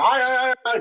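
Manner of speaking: lightly imitated high panicked stammer from Alpha; Alpha yelling. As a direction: mimic Alpha's panic in passing. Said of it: "as Alpha's yelling".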